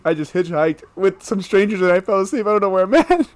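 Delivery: hammed-up fright between laughs; mock scared. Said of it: "I was scared".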